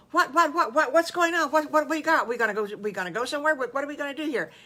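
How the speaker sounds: loud, high, tumbling questions; high-pitched frantic curiosity; excited clamour. Acted. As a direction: blurt questions over each other like an excited gang. Excited friends clamouring for news.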